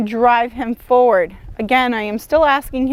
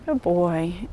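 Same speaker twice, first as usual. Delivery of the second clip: soft praise; 6 semitones lower, 7 dB quieter than usual. Real, not acted. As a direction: soothe the horse quietly. Praising horse during lunging.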